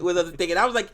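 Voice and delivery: normal talking voice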